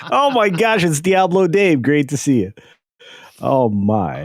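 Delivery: excited. Real, not acted. Greeting clip character excitedly.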